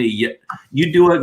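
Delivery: normal talking voice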